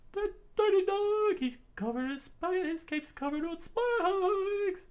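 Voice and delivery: very high, loud squeaky shriek; chef screaming. A narrator's reading. Chef yelling about the spiked cape, high loud shriek. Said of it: "the chef screamed out".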